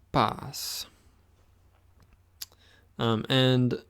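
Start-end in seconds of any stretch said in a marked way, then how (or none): 0.1-0.9 pas said short with a clear open a; model pronunciation. Shown as a demonstration. Teacher modelling pas for students to repeat, crisp. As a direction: say pas crisply, one syllable. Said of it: "And one last time".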